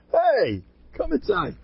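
high-pitched drawn call; mock usher's high holler. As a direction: hail someone to come in. Calling out to eulogy guests.

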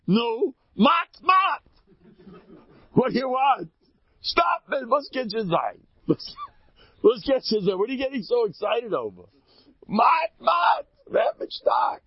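very high, loud exasperated shouting. Retold or imitated. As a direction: shout back annoyed from the roof.

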